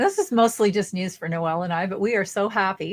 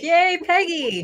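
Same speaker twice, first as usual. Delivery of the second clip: cheering; 10 semitones higher, 4 dB louder, much slower than usual. Real, not acted. Cheering the winner.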